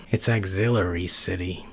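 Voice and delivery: ordinary delivery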